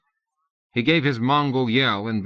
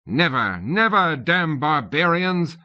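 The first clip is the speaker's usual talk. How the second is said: defiant cry; 7 semitones higher, slower than usual. A narrator's reading. Tutor fending off raiders.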